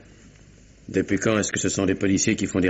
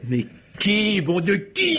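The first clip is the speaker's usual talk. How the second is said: desperate outburst; 14 semitones higher, much slower than usual. Acted. Desperate demand.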